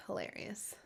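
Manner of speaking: unvoiced amused whisper; whispered aside. Real, not acted. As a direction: breathe it out under your breath.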